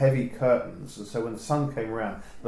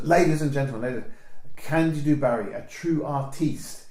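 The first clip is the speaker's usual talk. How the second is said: compère announcing; 3 semitones higher than usual. Retold or imitated. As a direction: introduce the star like a pub compère. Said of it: "she goes".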